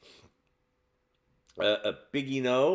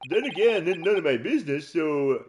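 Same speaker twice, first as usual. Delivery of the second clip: raccoon voice; 5 semitones higher than usual. Acted. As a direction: shrug it off slyly. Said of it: "give him a raccoon voice".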